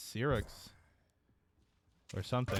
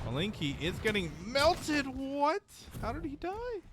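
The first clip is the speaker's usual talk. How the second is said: climbing higher into shocked disbelief; rising disbelief. Real, not acted. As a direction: get higher as it sinks in. Caster shocked by death.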